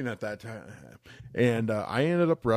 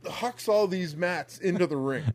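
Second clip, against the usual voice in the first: ordinary talk, nothing marked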